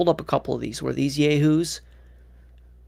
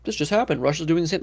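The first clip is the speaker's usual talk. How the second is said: higher-pitched mock explainer voice; imitando um comentador; 5 semitones higher than usual. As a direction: put on a high voice, quoting the encyclopedia's writers. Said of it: "they're, like, saying".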